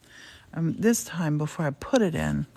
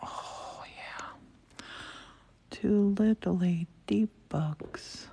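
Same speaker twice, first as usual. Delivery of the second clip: whispering; 3 semitones higher, 4 dB quieter, much slower than usual. Real, not acted. Painting vlog, soft whisper.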